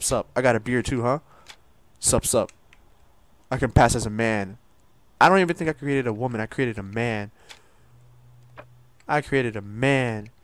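usual speaking voice

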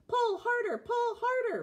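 high, straining call; mild puffing. A narrator's reading. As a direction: push the words out with effort. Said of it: "puffed Thomas".

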